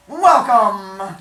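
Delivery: booming welcome call; loud villain greeting. Acted.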